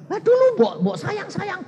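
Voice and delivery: high, loud ribbing; preacher ribbing a husband about past sweetness; mock teasing. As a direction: rib him loudly. Teasing married man, shouted high.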